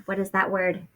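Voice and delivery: ordinary delivery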